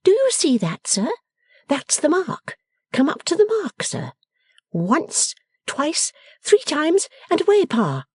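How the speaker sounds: high daughter's voice giving orders; playfully commanding Bella. A narrator's reading. Bella lining Pa up at the mark, playfully bossy. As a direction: count him off to the start, pitched high.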